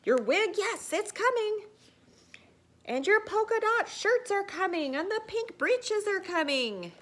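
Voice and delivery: high, loud booming announcements; blaring town crier calling out; bullhorn shout. Acted. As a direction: holler it like a bullhorn. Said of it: "like a bullhorn in a china shop".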